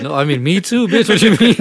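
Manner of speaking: mock indignant. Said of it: "He was like".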